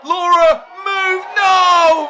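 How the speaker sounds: loud, high pleading yell; urgent shout. Real, not acted. Commentator yelling at Laura to dodge the cannonball, pleading shout. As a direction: scream at her to get out of the way. Urging wrestler before cannonball.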